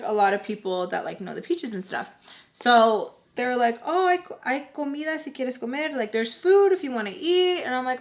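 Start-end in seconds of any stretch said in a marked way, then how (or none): 3.9-7.6 high-pitched, lively food offer; welcoming host. Retold or imitated. Party hosts offering her food, high welcoming voice. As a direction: imitate a hostess, pitched up.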